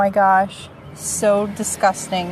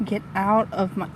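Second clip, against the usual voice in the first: ordinary talk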